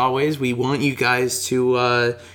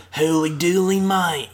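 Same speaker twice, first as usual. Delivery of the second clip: hammed-up Australian accent, pitched higher; exaggerated Australian accent; 3 semitones higher than usual. Shown as a demonstration. Co-host showing friend how to sound most Australian, hammed-up Australian accent. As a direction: do your most over-the-top Australian accent. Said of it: "Do it in just your most Australian accent".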